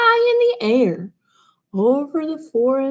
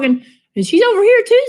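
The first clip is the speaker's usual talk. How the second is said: excited; 10 semitones higher, 6 dB louder, much faster than usual. Acted. Cat finding shards.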